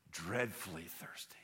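slow whispered answer; parched whisper. Acted. Thirsty Jill answering Aslan, slow whisper. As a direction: whisper it slowly, drained by thirst.

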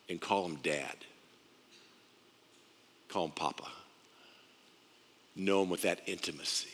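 low, soft and tender; quiet, reverent closeness; hushed intimacy. Real, not acted. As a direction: drop right down, with long pauses. Intimate names for God as Father.

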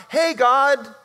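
high, loud, chummy call; breezy greeting, mocked. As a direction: greet God too casually, loudly. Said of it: "is not".

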